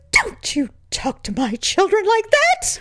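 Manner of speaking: high, slow indignant mother; protective mother tone. Retold or imitated. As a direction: defend her kids, slow and high. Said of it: "they all can do that tone".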